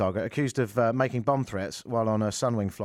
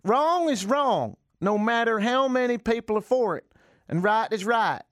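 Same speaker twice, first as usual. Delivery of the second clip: mock preacher; 8 semitones higher than usual. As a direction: pronounce it like a self-important pastor. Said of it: "Farias said".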